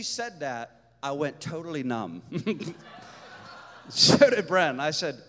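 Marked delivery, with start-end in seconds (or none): 2.3-3.8 soft voiceless chuckle; breathy laugh. Real, not acted. Speaker recalling going numb, breathy laugh. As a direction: let out a quiet, airy laugh.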